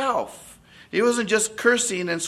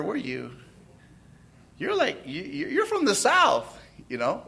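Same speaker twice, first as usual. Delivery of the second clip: ordinary talk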